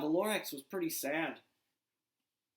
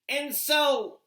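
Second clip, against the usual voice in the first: loud, high opening call; a big storybook Lorax voice; Lorax proclaiming; 10 semitones higher, 11 dB louder than usual. Acted. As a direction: announce it boldly as the Lorax. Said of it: "said the Lorax".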